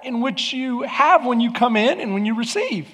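high, loud preacher's call to the congregation; full-force preaching. Acted. Pastor urging the congregation, full-force preaching. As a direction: preach it out to the whole room.

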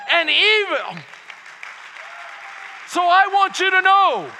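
loud, slow, building sermon; preaching. Real, not acted. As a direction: lift the congregation with each phrase. Preaching at full force.

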